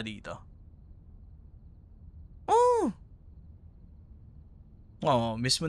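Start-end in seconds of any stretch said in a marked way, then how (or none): 2.5-2.9 surprised oh. Acted. Reacts to friend's enjoyment.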